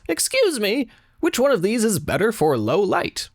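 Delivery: high-pitched put-on woman's voice; inquiring customer. Woman holding two plants asks a shopper, high put-on voice. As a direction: lift the voice high for a customer's question.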